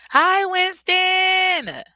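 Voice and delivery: stretched-out high, loud hello; drawn-out cheery welcome to a viewer; sing-song greeting. Real, not acted. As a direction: call the name out long and high.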